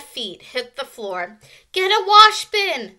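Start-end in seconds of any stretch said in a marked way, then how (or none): 1.7-3.0 high, loud urgent yell. A narrator's reading. Girl to cabin mates chasing a mouse, yelling. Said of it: "someone yelled".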